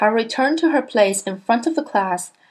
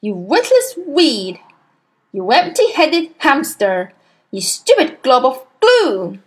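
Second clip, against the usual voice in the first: string of insults; 5 semitones higher, 5 dB louder than usual. A narrator's reading. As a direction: hurl each insult harder. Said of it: "the Trunchbull bellowed".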